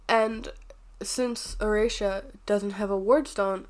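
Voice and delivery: usual speaking voice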